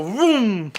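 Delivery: a quick mouth-made engine vroom; car engine vroom. Retold or imitated. Imitating Tommy Dreamer's car speeding away, vroom. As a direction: zoom off like a car pulling away.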